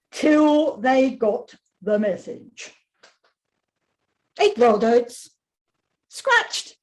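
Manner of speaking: deadpan diary read with long pauses; reading to children. A narrator's reading. Author reading her wombat book aloud to a class, dry and deliberate.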